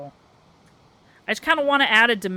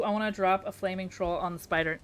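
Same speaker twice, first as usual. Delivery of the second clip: normal talking voice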